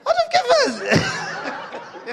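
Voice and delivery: very high, slow incredulous squeal; drawn-out comic double take; shocked disbelief. Retold or imitated. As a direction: squeal the echo in mock horror. Husband echoes phrase in shock.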